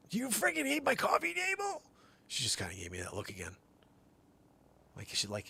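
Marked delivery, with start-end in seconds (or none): 0.1-1.8 much higher, put-on scolding voice; high mock scolding of the dog; exasperated high question. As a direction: scold the dog, squeaky and fed up.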